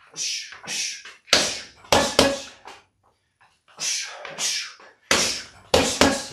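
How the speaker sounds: sharp whispered hush with each punch; hush exhales. Shown as a demonstration. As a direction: breathe out hard on every hush. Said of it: "Letting those hush, hush punches snap out".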